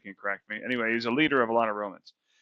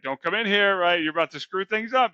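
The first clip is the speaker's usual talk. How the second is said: higher, louder warding-off; mock protest; 8 semitones higher, 5 dB louder than usual. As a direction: fend off an intruder, half-hearted mock alarm. Simon Peter turns centurion away at door.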